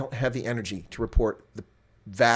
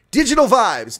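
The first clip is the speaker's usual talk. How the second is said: big booming announcement of the name; loud announcement; 11 semitones higher, 10 dB louder than usual. Acted. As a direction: proclaim it like a headline. Announcing scandal name dramatically.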